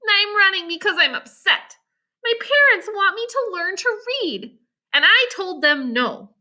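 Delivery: put-on a girl venting her frustration; Jane, upset. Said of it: "Jane frowned".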